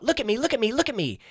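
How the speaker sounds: high, loud mocking look at me; mock a needy comic begging for eyes; attention seeker. Mocking attention-hungry comics, high and loud. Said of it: "it's all about".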